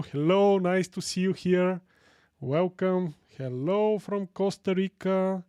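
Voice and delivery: ordinary delivery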